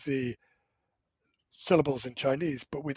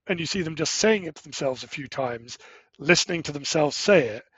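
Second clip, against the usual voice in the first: normal talking voice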